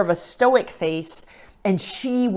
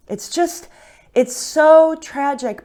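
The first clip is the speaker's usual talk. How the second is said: choked up; 8 semitones higher, 9 dB louder, slower than usual. Real, not acted. Choked up retelling martyrs' deaths.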